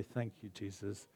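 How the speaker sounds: soft, hushed inner prayer; gentle whispered-down thanks; quiet prayer. Shown as a demonstration. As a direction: pray it under your breath. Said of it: "in your heart, say".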